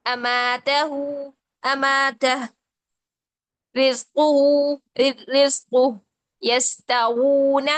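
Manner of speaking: slow drawn-out Arabic words, each with a pause; slow Quran recitation. Shown as a demonstration. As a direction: recite each word slowly for students. (धीमी तिलावत की मश्क)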